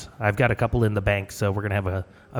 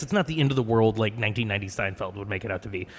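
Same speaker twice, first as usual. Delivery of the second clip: usual speaking voice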